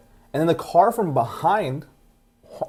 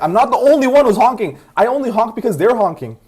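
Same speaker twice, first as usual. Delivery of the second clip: higher, louder retold comeback; heated, raised-voice protest; indignant retort; 8 semitones higher, 7 dB louder than usual. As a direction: argue back, retelling the row.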